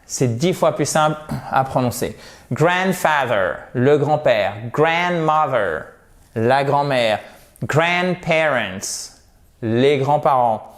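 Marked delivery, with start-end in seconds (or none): 2.5-3.6 slow "grandfather" with a strong GRAND; stress on first part. Shown as a demonstration. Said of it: "l'accentuation sur la première partie du mot". 4.7-5.8 slow "grandmother" with a strong GRAND; stress on first part. Shown as a demonstration. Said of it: "l'accentuation sur la première partie du mot". 7.6-9.2 very slow "grandparents", GRAND on top, falling away; stress on first part. Shown as a demonstration. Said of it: "l'accentuation sur la première partie du mot".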